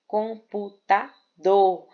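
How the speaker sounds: computador slowly split as com-pu-ta-DOR; stress on last syllable. Shown as a demonstration. Teacher giving an oxytone example, computador syllable by syllable with stressed DOR. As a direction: break computador into beats and land on DOR. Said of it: "a última sílaba é a sílaba mais forte".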